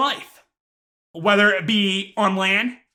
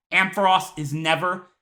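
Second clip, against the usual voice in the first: usual speaking voice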